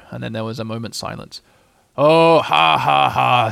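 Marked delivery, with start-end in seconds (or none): 2.0-3.5 loud, joyless mock laugh; bitterly spoken ha-ha-ha. A narrator's reading. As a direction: drag out a sarcastic laugh. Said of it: "said Eeyore very bitterly".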